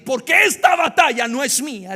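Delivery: loud, high proclamation; declared battle cry. Acted. David's words echoed to the church, loud. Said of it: "camina como dijo David".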